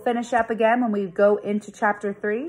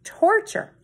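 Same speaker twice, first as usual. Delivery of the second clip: high dramatic exclamation; mock outrage; 13 semitones higher than usual. Narrator voicing John's disgust at detention, mock outrage. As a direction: ham up the horror of it.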